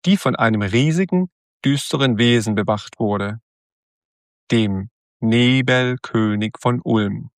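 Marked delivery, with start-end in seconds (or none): none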